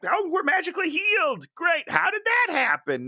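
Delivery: high, mocking, falsely chipper; sarcastic mock voice. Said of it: "they're just like".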